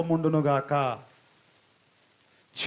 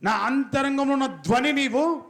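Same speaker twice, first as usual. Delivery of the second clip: higher, slow fervent prayer line; prayer recitation; 8 semitones higher than usual. Shown as a demonstration. Preacher showing the congregation how to pray, higher and slow. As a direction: pray it out slowly, lifting each phrase. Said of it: "చెప్పాలి ఒకసారి".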